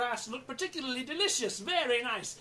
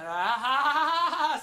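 long, loud bleating; sheep bleat; 3 semitones higher, 6 dB louder than usual. Shown as a demonstration. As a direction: show off a sheep's baa. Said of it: "baa like sheep?".

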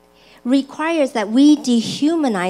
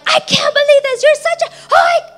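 high, sputtering mock outburst; acted-out exasperated argument; heated anger; faster than usual. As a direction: rant until the words run out. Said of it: "the conversation's getting heated".

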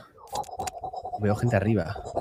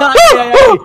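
laughing; 9 dB louder, slower than usual. Real, not acted. Laughing after escape.

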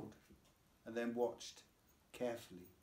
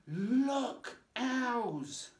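high, loud delighted cry; excited exclaim; 12 semitones higher, 8 dB louder than usual. A narrator's reading. Lucy spotting real owls at the sanctuary, loud and high. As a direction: burst out with delight. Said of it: "she exclaimed".

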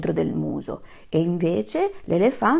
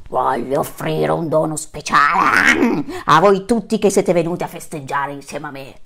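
lion king; 3 semitones lower, 6 dB louder than usual. Acted. Lion king addresses animals.

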